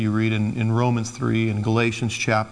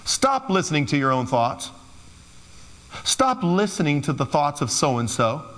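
ordinary delivery

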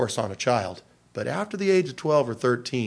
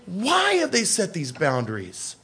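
protesting youth; 10 semitones higher than usual. Retold or imitated. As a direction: squeak out the why.

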